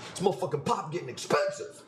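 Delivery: lightly hammed Chicago accent, gruff. Streamer shows chat how Chicago people talk. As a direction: toss it off like a Chicago guy. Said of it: "That's how we talk".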